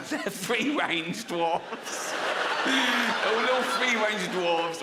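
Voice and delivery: slow, giggling delivery; laughing through words. Real, not acted. Comic giggling through the free-range dwarves line.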